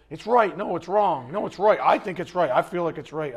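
mock bickering, high and loud; hammed-up emotional back-and-forth argument; all emotional. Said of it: "If I get all emotional".

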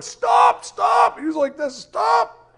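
high, loud shouted stop; shouting stop. Retold or imitated. Ball guy yelling at the runaway, a high loud stop. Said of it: "the ball guy was like".

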